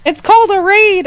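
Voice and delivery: high urgent yell to stop; husband yelling. Retold or imitated. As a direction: call out to stop her, urgently. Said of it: "my husband was like".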